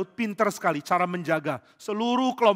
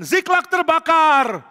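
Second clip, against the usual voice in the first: high, loud, slow cry of alarm; alarmed shout; 11 semitones higher, 10 dB louder, much slower than usual. Acted. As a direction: shout the news that the town burns. Soldier sees burning town.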